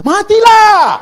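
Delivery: high, loud comic shout; shouted punchline. Acted. Preacher shouts the husband's fate to the congregation. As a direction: yell it out as the punchline.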